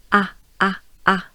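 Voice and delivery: "A" as an open "ah"; exaggerated French A. Shown as a demonstration. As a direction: open wide and punch each "A". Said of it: "you want to exaggerate it".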